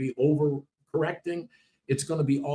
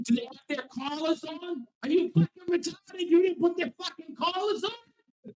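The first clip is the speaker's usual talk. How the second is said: much higher, exasperated ranting; mock angry yell. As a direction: berate them like they forgot the obvious. Imitates yelling at someone.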